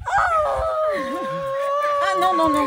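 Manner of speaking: high loud cry, laughing and crying; overwhelmed joy, close to tears; shocked and tearful. Real, not acted. Grandmother surprised and moved.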